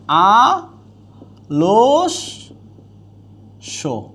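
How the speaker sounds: আলস্য stretched very long and slow; long drawn-out demo. Shown as a demonstration. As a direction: hold আলস্য out for the class. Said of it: "teacher demonstrates pronunciation".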